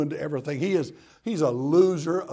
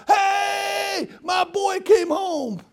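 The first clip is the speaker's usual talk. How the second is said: high, loud, drawn-out welcome holler; jubilant yell; 5 dB louder, much slower than usual. Acted. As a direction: shout it as an overjoyed father. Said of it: "but he yells".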